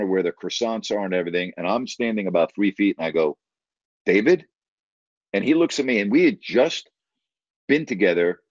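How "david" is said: retold questioning call, rising at the end; tentative greeting. Narrator hails an acquaintance by the croissants, tentative and questioning. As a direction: call the name as an unsure question.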